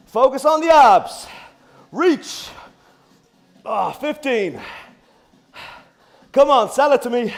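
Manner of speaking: strained high loud countdown; doing the squirm. Real, not acted. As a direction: push through the burn. Counting down while exercising.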